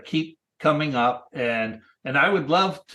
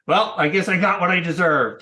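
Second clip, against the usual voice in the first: higher, louder mock-rueful quote; good-humoured resignation; 5 semitones higher, 5 dB louder than usual. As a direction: imitate someone laughing off their own comeuppance. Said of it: "you'd shake your head and go".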